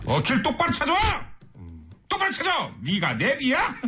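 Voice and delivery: high-pitched comic scolding; hammed-up exasperated outburst; mock anger. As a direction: order the sat-nav about in pretend fury. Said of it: "막 더 화내".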